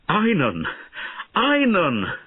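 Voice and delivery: shouting a name. Acted. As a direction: call out to the fallen boy in panic. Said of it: "shouted Bowen".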